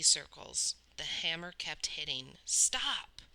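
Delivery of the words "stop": high breathless cry; gasped. A narrator's reading. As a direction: gasp it out under the blows. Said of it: "Cole gasped".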